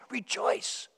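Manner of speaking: breathy whisper, almost secret; a soft whispered call to rejoice. Real, not acted. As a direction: let the word fall to a hushed whisper. Pastor urging joy, drops to whisper.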